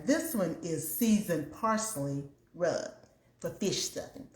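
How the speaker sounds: usual speaking voice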